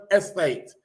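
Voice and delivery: ESTATE picked out at the end; stress on ESTATE. Shown as a demonstration. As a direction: lean pointedly on the word ESTATE. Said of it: "I'm putting the emphasis on the word".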